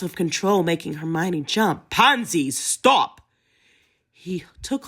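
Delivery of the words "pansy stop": higher, louder sharp command; almost shouting. A narrator's reading. Theo nearly shouts to stop Pansy, losing control. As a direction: lose control and shout her name. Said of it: "Theo almost shouted now".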